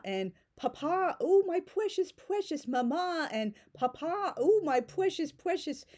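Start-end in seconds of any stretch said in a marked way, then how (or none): 0.6-3.3 high, slow, syrupy mock sweetness; hammed-up doting child voice; gushing sappy daughter. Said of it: "an over-the-top parody". 3.7-5.9 high, slow, syrupy mock sweetness; hammed-up doting child voice; gushing sappy daughter. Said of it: "an over-the-top parody".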